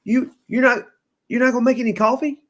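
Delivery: high, rushed, exasperated retelling; aggravated. Retold or imitated. Friend retold asking for coffee, high and aggravated. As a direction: act out a fed-up customer in disbelief. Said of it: "Wade's starting to get aggravated".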